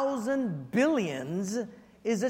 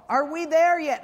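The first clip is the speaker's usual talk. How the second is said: put-on higher, louder whiny child; nagging kid; 6 semitones higher, 5 dB louder than usual. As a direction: whine it like a bored little kid. Kids nagging again.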